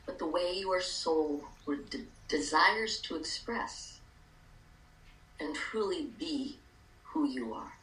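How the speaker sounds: calm guided meditation, hushed. Acted.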